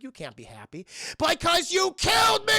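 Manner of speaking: high, loud mock outburst; shouted accusation. Same warning bursts into accusation.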